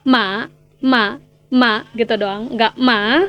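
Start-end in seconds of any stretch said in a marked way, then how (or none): 0.0-1.9 "ma" kept low, no final rise; low half third tone. Shown as a demonstration. Teacher shows everyday Mandarin, "ma" said only low. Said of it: "ngomongnya yang nada rendahnya aja". 2.8-3.2 "ma" swung down and back up; full dip-and-rise tone. Shown as a demonstration. Teacher shows what isn't used in conversation, "ma" rising at the end. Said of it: "nggak gitu ya kalau di percakapan".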